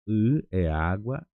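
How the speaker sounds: "I é água" said slowly and carefully; slow pronunciation drill. Shown as a demonstration. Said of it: "treinando a pronúncia". ("I é água" devagar, para treinar)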